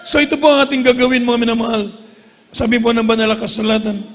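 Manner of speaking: usual speaking voice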